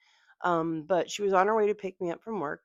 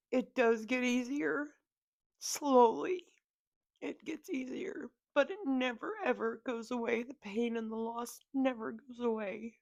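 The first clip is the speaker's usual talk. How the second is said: tearful, higher voice with halts; crying; 4 semitones higher than usual. Real, not acted. Grieving daughter telling viewers about loss, crying. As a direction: struggle on through tears. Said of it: "sorry for crying like this".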